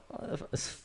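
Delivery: cut-off whisper. Retold or imitated. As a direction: whisper and break off. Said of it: "And we were like".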